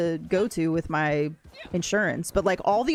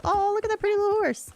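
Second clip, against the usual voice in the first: cooing at a horse. Real, not acted. Gamer coos at a pretty horse in game, high baby voice. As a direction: coo over the cute little horse.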